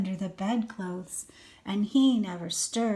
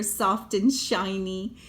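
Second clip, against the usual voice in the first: ordinary delivery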